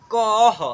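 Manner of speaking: कह said very slowly and loud, with a breathy h after the a; visarga kah. Shown as a demonstration. Teacher demonstrating the visarga ending for children, slow and loud. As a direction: stretch out कह and push air out for the final h. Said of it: "अह के रूप में उच्चारण करेंगे".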